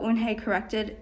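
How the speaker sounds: ordinary delivery, nothing marked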